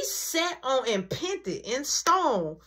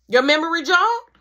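stern mom; 7 semitones higher, 7 dB louder than usual. Retold or imitated. Mom interrogating son.

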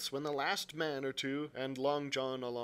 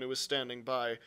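ordinary delivery

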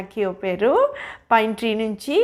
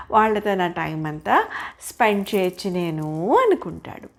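ordinary delivery, nothing marked

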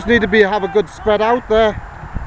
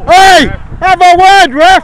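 higher, louder, slow shout at the ref; drawn-out protest; yelling from the sidelines; 5 semitones higher, 6 dB louder than usual. Real, not acted. Coach shouting at referee.

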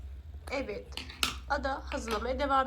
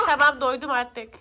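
louder whining protest; loud whiny protest; 2 semitones higher, 13 dB louder than usual. Real, not acted. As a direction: whine it loudly, fed up. Child pleading, fed up.

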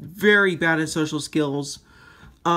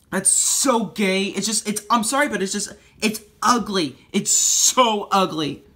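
disgusted whine; 5 semitones higher than usual. Real, not acted. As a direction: drag out your disgust. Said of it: "that's how annoyed I am".

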